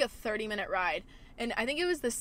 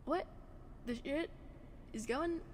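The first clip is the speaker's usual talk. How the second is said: surprised mutter; 2 semitones higher, 9 dB quieter than usual. Real, not acted. Surprised by dad arriving.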